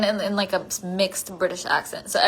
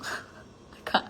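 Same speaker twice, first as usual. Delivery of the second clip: ordinary delivery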